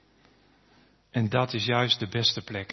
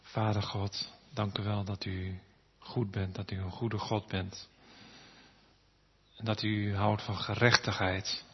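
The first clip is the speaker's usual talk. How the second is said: hushed, reverent prayer voice. Acted. Closing prayer to God.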